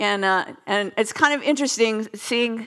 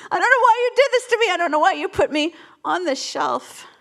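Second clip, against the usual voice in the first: whiny complaint; 7 semitones higher than usual. Retold or imitated.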